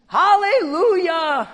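long, drawn-out high holler; slow, ringing yell of praise; exultant shout. Acted. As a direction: stretch it into a loud cry. Repeats the heavenly shout of praise.

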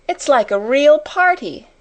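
high, loud burst of delight; delighted cry. A narrator's reading. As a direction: cry out, thrilled by the party. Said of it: "cried Ermengarde".

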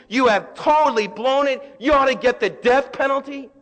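loud, high-pitched pulpit preaching; fiery preacher proclaiming forgiveness; full-force preaching. Acted. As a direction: preach it out to the congregation with fire.

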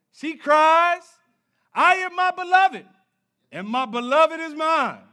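rising sermon fervour; full force preaching. Acted. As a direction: preach it hard to the congregation.

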